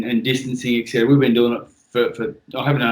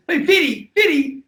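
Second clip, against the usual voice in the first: put-on much higher, calling out a name like an excited kid; little boy; 3 dB louder than usual. Young son greeting dad's podcast mate, high excited kid voice. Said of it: "He goes".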